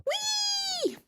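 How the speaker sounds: high, gleeful whoop; thrilled while running; gleeful whee. Acted. Sprinting gleefully.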